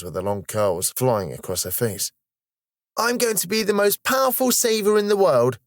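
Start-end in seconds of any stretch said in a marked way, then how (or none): 3.0-5.6 high, sustained calling voice; high-pitched boastful child shouting; child's excited shout. A narrator's reading. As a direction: proclaim it across the classroom as a little girl. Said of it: "shouted Star from the back".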